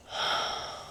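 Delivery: slow, audible out-breath; airy, releasing sigh; long exhale. Shown as a demonstration. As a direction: breathe out fully to model relaxation. Models breathing out for client.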